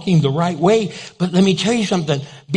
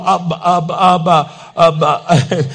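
flustered stammer; slower than usual. Retold or imitated. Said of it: "she's going".

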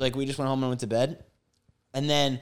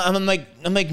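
ordinary talk

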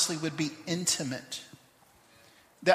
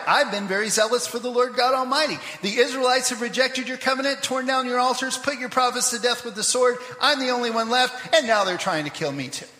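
higher, louder self-pitying whine; whiny complaint; 5 semitones higher, 5 dB louder than usual. Shown as a demonstration. Elijah whining his complaint to God, higher and louder. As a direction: complain like a sulking child, half sung. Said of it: "watch this, see if this sounds familiar".

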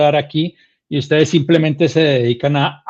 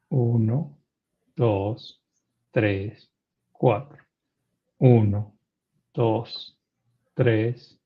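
slow, soft, lower meditation count; guided breathing count; 4 semitones lower, 7 dB quieter, much slower than usual. Acted. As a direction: count each breath calmly and softly.

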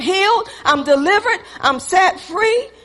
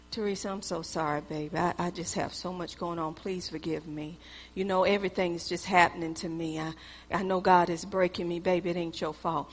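much softer and lower, pleading tenderly; soft apology; 12 semitones lower, 15 dB quieter than usual. Retold or imitated. Pastor begging his wife Teresa to forgive him, soft and pleading. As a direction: coax her into forgiving, hushed.

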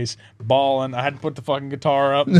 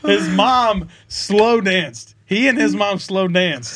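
loud, slow correction; emphatic correction; 6 semitones higher, 4 dB louder, much slower than usual. Real, not acted. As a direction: correct it firmly, slowly. Correcting own misspeak.

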